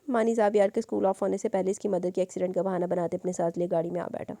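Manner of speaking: usual speaking voice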